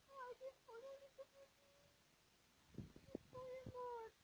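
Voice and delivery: very high, cooing coddling voice; sing-song what's-wrong-sweetie to a fussing baby; high baby talk. Acted.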